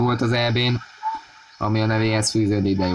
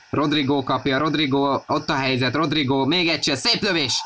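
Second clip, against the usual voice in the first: rising, urgent play-by-play; excited commentary; 6 semitones higher than usual. Real, not acted. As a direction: build the call up fast. Goal chance, commentator rising. (izgatott kommentár)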